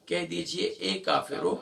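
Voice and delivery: ordinary delivery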